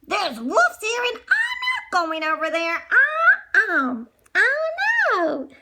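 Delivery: high, frightened wail about the wolves; frightened cry. Acted. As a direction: whimper with fear, voice climbing high. Maurice hears wolves, terrified.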